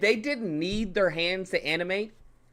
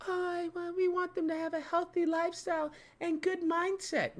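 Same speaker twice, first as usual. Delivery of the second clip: high, soft simpering voice; mocking PC studio talk; 12 semitones higher, 7 dB quieter than usual. Streamer ridicules healthy lifestyle talk, mocking high soft voice. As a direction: mimic a prissy do-gooder in a tiny high voice. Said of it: "Fuck all this".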